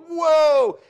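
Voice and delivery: loud, high swooping whoa; imitated skid. Back end's going the other way, loud swooping whoa. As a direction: throw out a whoa as the car fishtails. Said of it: "and the back end's going".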